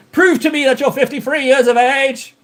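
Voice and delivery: high-pitched playful demand; mock challenge. Mock challenge after laughing.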